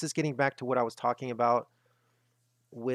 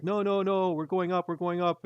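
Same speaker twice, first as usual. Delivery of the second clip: higher, mock-confident bull voice; 5 semitones higher than usual. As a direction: parody the overconfident bulls, pitched up. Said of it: "And they're like".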